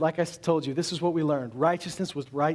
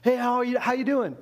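breezy greeting; 6 semitones higher than usual. Retold or imitated. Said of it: "That's like".

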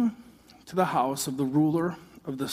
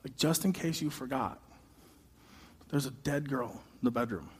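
much softer, urgent under-the-breath plea; hushed aside; 4 semitones lower, 8 dB quieter than usual. Retold or imitated. A disciple urgently hushes to Jesus about the dead girl, softly. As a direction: pull Jesus aside and whisper it. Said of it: "I'm like".

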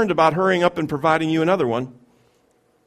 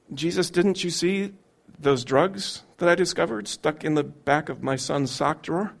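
quiet anguished plea; 4 dB quieter than usual. Acted. Believer's quiet plea to Jesus.

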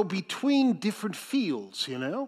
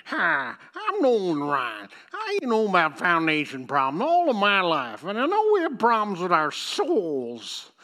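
Nolan Ryan; 4 dB louder than usual. Retold or imitated. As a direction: imitate an old Texas ballplayer's radio ad, vowels stretched. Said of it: "he just had that accent".

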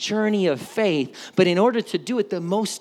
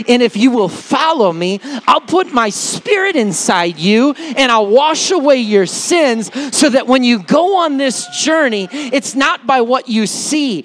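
loud, higher, driving sermon delivery; raised preacher's voice, punching key words; full-force preaching; 4 semitones higher, 8 dB louder than usual. Acted. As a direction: proclaim the promise to the whole room. Preaches God's promise at full force.

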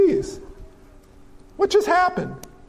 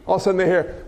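ordinary talk

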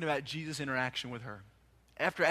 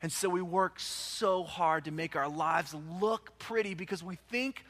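normal talking voice

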